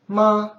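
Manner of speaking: MA high, long and level; first tone. Shown as a demonstration. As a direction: hold MA on a high, even note. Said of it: "Review again".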